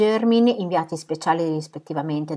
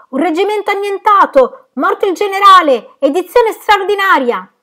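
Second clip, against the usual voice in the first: shouting newsboy; 12 dB louder than usual. A narrator's reading. Newsboy shouting up at the window, extra-edition cry. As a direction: holler it up to the windows. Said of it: "vociando verso l'alto".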